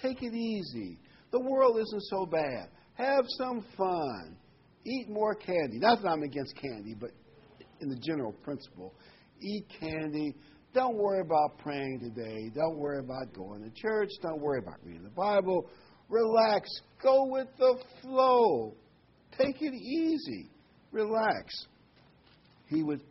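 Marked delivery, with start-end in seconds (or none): 0.0-5.8 lightly voiced coaxing devil, higher; the devil luring believers, lightly mock soothing; tempting devil. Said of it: "if I were the devil, I would be saying". 9.4-21.7 the devil tempting churchgoers to slack off, mock soothing. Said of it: "if I were the devil, I would be saying".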